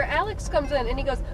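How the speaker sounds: usual speaking voice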